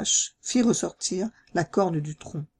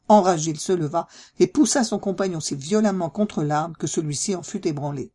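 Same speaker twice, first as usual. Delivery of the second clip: usual speaking voice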